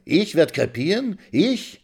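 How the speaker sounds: sharply rising, high; incredulous, indignant; old woman shocked by the curse; outraged disbelief. Acted.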